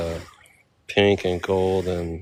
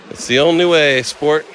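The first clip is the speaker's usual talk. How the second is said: higher, louder playful slogan; hammed-up voice; 6 semitones higher, 7 dB louder than usual. Tourist hamming it up after the name, loud playful voice. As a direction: belt it out high and jokey.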